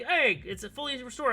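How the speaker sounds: excited news. Retold or imitated. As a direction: rush to share the good news.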